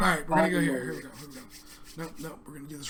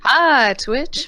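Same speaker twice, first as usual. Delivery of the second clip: loud greeting; 7 semitones higher, 10 dB louder than usual. Real, not acted. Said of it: "Why are we whispering?".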